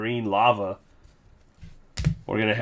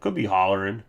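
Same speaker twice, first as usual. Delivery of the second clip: ordinary talk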